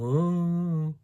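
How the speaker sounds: long, high hooting moan; imitated response. Narrator imitating the unseen presence's answer, high drawn-out hoot. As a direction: mimic a wordless call from the trees. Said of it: "the sound of response".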